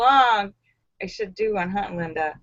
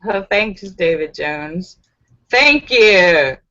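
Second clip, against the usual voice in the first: sarcastic; 7 semitones lower, 3 dB louder than usual. Real, not acted. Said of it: "note the sarcasm".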